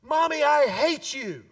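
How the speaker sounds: put-on high, loud bratty child's yell. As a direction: yell it like an angry five-year-old. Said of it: "your five-year-old yells at you".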